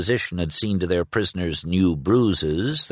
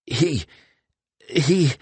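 gasping; 7 semitones higher than usual. A narrator's reading.

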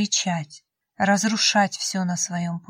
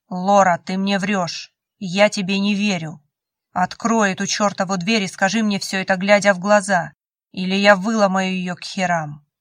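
louder, furious demand at a locked door; voice at breaking point; 5 dB louder than usual. Acted. Cole yells at Laura through the door, demanding she open it, loud and furious. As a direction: shout him down through the door, at the end of his rope. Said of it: "Его голос был на пределе".

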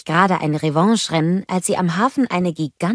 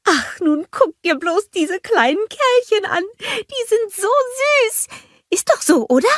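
cheery greeting. A narrator's reading. Olaf greeting the snowgies, high and happy. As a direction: call out warmly to the little ones. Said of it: "meinte Olaf".

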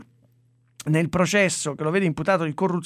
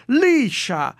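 mock refined; 8 semitones higher, slower than usual. Mocking the water sommelier article.

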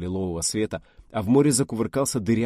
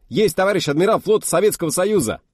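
high, loud full-throated bellow; parade shout; 11 semitones higher, 9 dB louder than usual. Acted. Officer confirming to the fleet admiral, loud bellow. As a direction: belt out the whole title.

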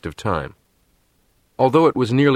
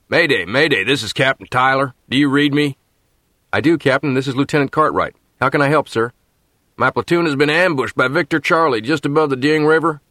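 urgent radio call; 5 semitones higher than usual. Acted. Radio mayday under fire.

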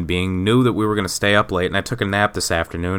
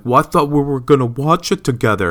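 whiny higher mock of the wife; 5 semitones higher than usual. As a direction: parody her complaint, pitched up. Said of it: "she's like".

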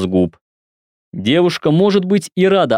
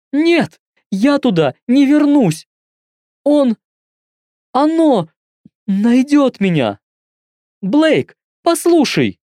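put-on high, scared girl's voice, slowing down; frightened young woman; 13 semitones higher than usual. A narrator's reading. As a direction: voice her terrified of going back. Frightened caller refuses.

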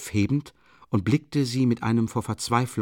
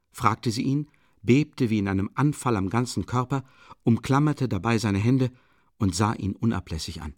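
ordinary delivery, nothing marked

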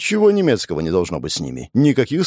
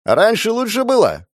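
high, loud, agreeing shout; affirming shout; 11 semitones higher, 4 dB louder than usual. Acted. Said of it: "прокричал утверждающий Костенко".

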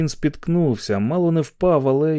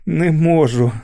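breathless gasp; 4 semitones higher, 4 dB louder, slower than usual. Acted. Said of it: "видихнув Гарреті".

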